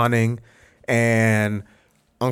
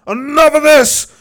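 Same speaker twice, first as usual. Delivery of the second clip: high, loud shout imitating a furious uncle; enraged outburst; 9 dB louder than usual. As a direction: explode like an uncle who has had enough. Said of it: "slams his hands on the table".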